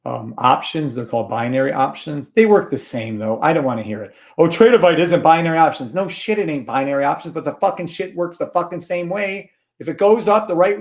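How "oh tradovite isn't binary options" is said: louder mock complaint; mocking objection. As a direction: parrot a nitpicking critic, loudly. Mocking viewers' objection, acted quote.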